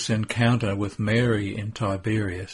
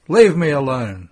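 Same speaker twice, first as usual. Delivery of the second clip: loud, higher rude dismissal; rude snap; 4 semitones higher, 9 dB louder than usual. Acted. Judas brushing off Mary, loud and rude. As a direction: shove the words at her. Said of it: "says Judas rudely".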